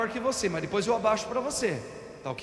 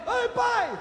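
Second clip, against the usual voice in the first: put-on child's cry; 13 semitones higher than usual. Child falls off bike.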